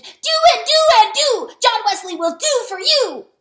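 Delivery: very high, full-lunged battle scream. A narrator's reading. Weasel charging back to war, screaming. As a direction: scream a vow of revenge to cracking. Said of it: "and he screamed".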